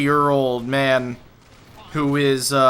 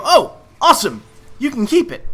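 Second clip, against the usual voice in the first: cheery casting reply; 10 semitones higher, 4 dB louder than usual. Retold or imitated. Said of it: "they go".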